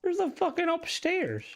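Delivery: high-pitched, incredulous surprise; amazed discovery. Real, not acted. Discovers an unexpected upstairs.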